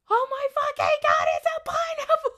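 high, loud, thrilled shriek of discovery; ecstatic squeal. Retold or imitated. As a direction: squeal like a kid on Christmas morning. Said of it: "and I'm just like".

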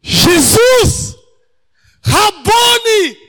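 grito alto e muito agudo de reconhecimento; grito de alegria. Retold or imitated. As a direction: grite o nome dele, bem mais agudo e forte. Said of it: "Ela grita". (Maria reconhecendo Jesus e gritando de alegria)

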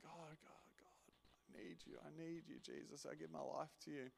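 broken high whisper, begging God; desperate whispered prayer. Retold or imitated. Kneeling at the altar, pleading with God, whispered. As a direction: whisper it on your knees, pleading. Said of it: "I'm just going".

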